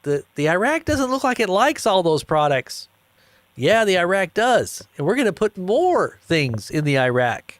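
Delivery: high, mocking back-and-forth; mock reporters. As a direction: voice the doubters then the CEO. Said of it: "they're like".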